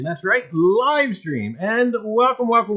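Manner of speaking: usual speaking voice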